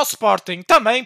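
ordinary talk

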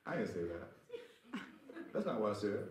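real laughter through the words; laughing. Real, not acted. Preacher teasing the congregation, laughing through his words. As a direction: laugh it off while correcting them.

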